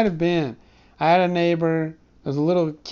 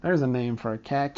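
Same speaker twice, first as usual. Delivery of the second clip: normal talking voice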